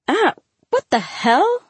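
high, drawn-out startled cry; alarmed, pitch leaping up. A narrator's reading. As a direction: react to a sudden flash. Startled by a sudden flash.

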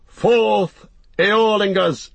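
high, loud rallying cry; ringing war cry, each word thrown out; battle shout. A narrator's reading. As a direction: send the riders charging off. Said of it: "they shouted".

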